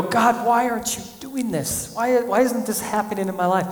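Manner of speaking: high whiny complaint to God; imitating a complaining prayer; mock whining. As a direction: whine like a frustrated believer. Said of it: "Sometimes we go".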